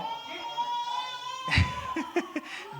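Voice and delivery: sudden high laughing burst; real laugh that breaks out high; a high cackle of disbelief; burst of high laughter. Real, not acted. Laughing at quoted preacher.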